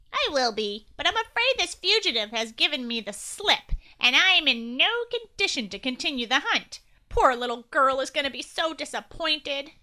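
loud nasally agent voice. Acted. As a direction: push it all through the nose, official. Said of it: "said in a nasally voice".

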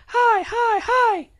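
put-on tiny high toddler chant. A narrator's reading. Little sister echoing the family, tiny high voice. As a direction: squeak it like a baby sister. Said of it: "because she is learning".

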